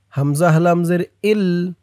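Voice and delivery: ordinary delivery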